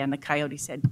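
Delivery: ordinary talk, nothing marked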